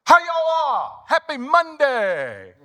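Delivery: very high, loud starstruck gush; high breathless gushing woman; excited lady fan. Retold or imitated. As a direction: greet them like a thrilled fan. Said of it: "she goes".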